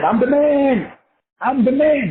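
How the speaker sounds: high, loud cocky boast; mock bragging voice, high and loud; boastful swagger. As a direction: puff yourself up. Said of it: "walk around and say".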